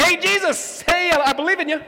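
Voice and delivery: high, loud last-second plea; a dying man's high, loud cry; pleading criminal. Retold or imitated. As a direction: beg Jesus from the cross.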